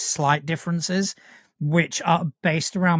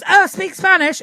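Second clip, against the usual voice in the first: high, louder mimicking of a pest; mock eager request; 5 dB louder than usual. Bilingual mimicking people who demand Spanish, high mocking voice. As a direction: imitate someone pestering, pitched right up.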